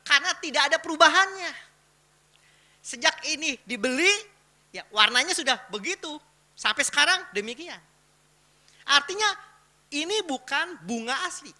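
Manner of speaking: high, loud voice projected to a crowd; emphatic preaching. Real, not acted. Preacher quizzing the congregation about a fake rose, emphatic preaching. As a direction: fire questions and answers at the whole room.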